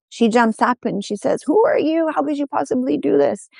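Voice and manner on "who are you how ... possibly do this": high-pitched, indignant startled questions; startled outrage. Acted. Woman jumping up at the man who suckled her, startled outrage. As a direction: demand an answer, pitched well up in shock.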